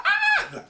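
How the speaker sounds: very high, loud cartoon yelp; mock death scream. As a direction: yelp like a soldier hit in the head. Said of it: "He's like".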